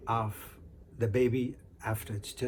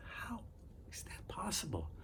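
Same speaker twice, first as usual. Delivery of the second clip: hushed aside; 7 semitones higher, 13 dB quieter than usual. A narrator's reading. Narrator's aside on the pregnancy.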